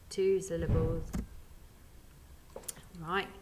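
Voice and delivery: usual speaking voice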